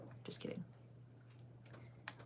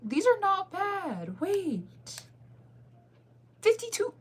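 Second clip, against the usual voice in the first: excited squeal; 13 semitones higher, 4 dB louder, much slower than usual. Real, not acted. Excited over seats.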